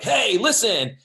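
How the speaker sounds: loud, high, overexcited greeting; over the top. Shown as a demonstration. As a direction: burst in far too big for a reserved listener. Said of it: "over the top".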